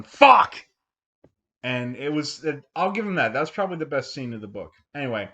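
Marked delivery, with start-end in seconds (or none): 0.0-0.6 sudden loud, high outburst of dismay; shouted curse. Retold or imitated. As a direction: explode on the word, acting out horrified realization. Acting scientist's bad news moment.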